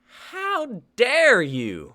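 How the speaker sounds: outraged. Acted. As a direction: drag out the indignation.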